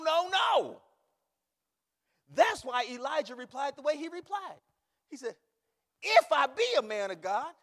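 loud, high preacher's shout; full-force preaching. Real, not acted. Preacher voicing Elijah's indignant reply, full-force preaching. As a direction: thunder the rebuke from the pulpit.

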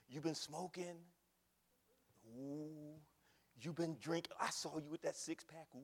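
low, soft snooping voice; mock fault-finding God. Preacher mocking people's idea of God inspecting sins, low and soft. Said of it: "This is what people think that God".

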